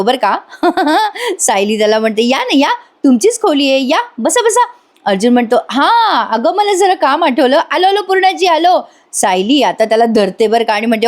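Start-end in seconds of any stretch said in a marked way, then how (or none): 2.3-4.7 high, syrupy mock welcome; overly sweet sarcastic invitation; hammed-up sarcastic hospitality. Sayali mockingly welcoming Arjun. 5.7-8.8 high, hurried excuse-making; higher, mock flustered dodge; flustered escape. Arjun fleeing Sayali's questions.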